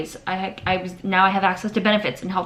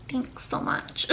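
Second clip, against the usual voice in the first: breathy mock thanks; 7 semitones higher, 10 dB quieter, slower than usual. Boss voiced brushing her off.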